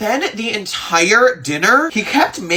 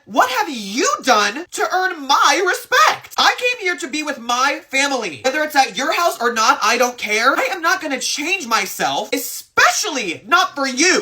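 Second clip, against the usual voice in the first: heated retort; 7 semitones higher than usual. Retold or imitated. Heated family argument at Christmas dinner.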